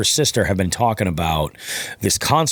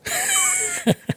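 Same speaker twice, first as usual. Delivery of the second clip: a short burst of real laughter; 4 dB quieter than usual. Real, not acted. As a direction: laugh it off, amused at yourself. Laughs after own joke.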